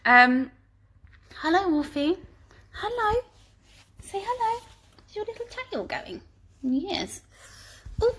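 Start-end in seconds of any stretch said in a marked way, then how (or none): 1.4-8.1 high, soft cooing to a pet; sweet, high-pitched coaxing for a little dog; doting dog talk. Real, not acted. Presenter greeting her dog Wolfie mid-stream, doting baby talk.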